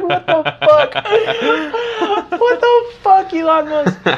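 very high, laughing through the words; laughing disbelief. Real, not acted. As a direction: squeal it out laughing.

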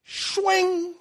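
high, ringing blade sound effect; imitated sword swish. Peter cuts the guard's ear off, voiced sword sound. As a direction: swing the blade with your voice.

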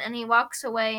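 usual speaking voice

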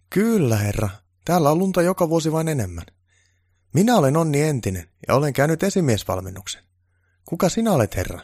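high, loud and keen to please; eager elf foreman. Acted. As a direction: play a self-important little elf greeting the boss. Voices Onni Entinen, keen elf foreman.

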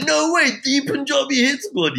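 high, starstruck shout; excited shout. Retold or imitated. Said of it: "why are you shouting".

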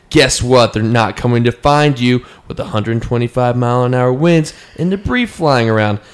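emphatic warning with jumping pitch; dramatic reading. Acted. Reading a hurricane evacuation rant aloud, dramatic reading. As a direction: read it as a stern, forceful warning.

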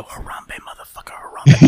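hushed mock-menacing whisper; whisper in her ear. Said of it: "when you got into her ear and you go".

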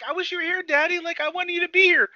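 high-pitched imitation of a pleading kid; pleading child. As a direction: play a little kid wishing for Daddy. Child wishing for absent father, retold.

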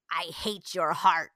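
slow, deliberate retold verdict; dead serious. Mom mimics her little one staring down dad, dead serious. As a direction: place each word slowly and deliberately. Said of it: "dead, looking him in the eyes".

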